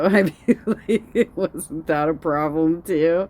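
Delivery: high, laughing disbelief. Real, not acted. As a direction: ask it through a laugh. Amused reaction to clip, laughing.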